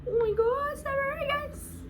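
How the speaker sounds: tiny, very high squeal; overwhelmed squeak. Real, not acted. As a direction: squeak out an overcome apology. Overcome by the scent.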